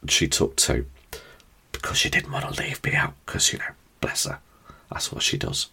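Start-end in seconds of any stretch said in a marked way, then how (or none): 1.7-5.7 whisper. Real, not acted. Fond aside about his partner.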